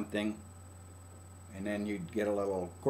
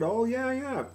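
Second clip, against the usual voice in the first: dismissive agreement; 12 semitones higher than usual. Retold or imitated. Listener brushing it off.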